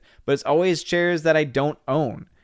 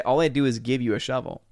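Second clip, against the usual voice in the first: ordinary talk, nothing marked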